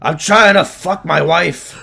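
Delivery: high-pitched, drawn-out pretend holler; mock yelled complaint. Co-host riffing as annoyed Leo in the doorway, mock yelling. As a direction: holler a joke complaint, slowly.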